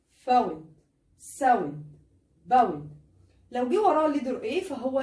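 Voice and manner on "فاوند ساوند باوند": each word with an ow diphthong. Shown as a demonstration. Teacher reading ow words for the class. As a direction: model the ow glide in each word. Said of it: "تاني،".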